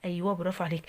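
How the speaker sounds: usual speaking voice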